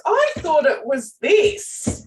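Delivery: high, drawn-out player objection; imitated player's protest. Player bringing up something from years ago, mock protest. Player springs an old idea.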